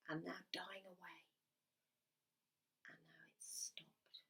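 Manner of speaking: hushed whisper as the sound fades. Real, not acted. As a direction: whisper so they keep listening. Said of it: "Can you still hear that?".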